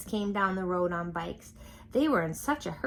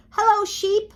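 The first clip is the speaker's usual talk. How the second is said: much higher, louder duck greeting; cheery duck; 10 dB louder than usual. A narrator's reading. Duck riding past the farm animals, high cheery greeting. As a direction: sing out hello like a jolly duck.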